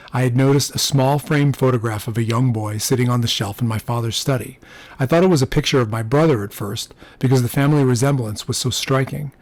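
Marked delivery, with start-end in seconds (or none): none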